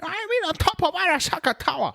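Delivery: very high, quick mock-Japanese accent; caricature Japanese accent. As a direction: repeat it as a squeaky fake Japanese accent. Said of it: "turned into a racist Japanese depiction".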